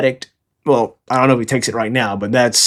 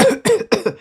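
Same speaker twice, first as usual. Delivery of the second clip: acted sputtering cough; mock coughing; 13 semitones higher than usual. Beaten Brooke answers the Marine, mock coughing. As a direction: cough weakly as if badly beaten. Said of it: "And Brooke is just like".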